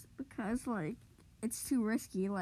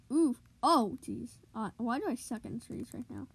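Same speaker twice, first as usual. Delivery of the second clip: normal talking voice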